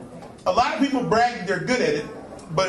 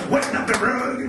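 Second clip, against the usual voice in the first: gruff mock scold; a hint of a cranky old man; Redd Foxx impression; 5 semitones higher than usual. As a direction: snap at them like an old grouch. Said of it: "Red Fox would be like".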